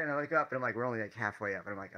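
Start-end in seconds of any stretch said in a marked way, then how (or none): none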